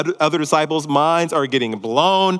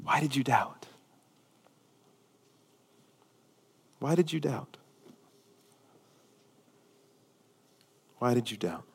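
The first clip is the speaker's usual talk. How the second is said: hushed gentle question; 5 semitones lower, 12 dB quieter than usual. Acted. Jesus gently questioning Peter after lifting him, hushed. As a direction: ask it tenderly, barely above a breath. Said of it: "and says".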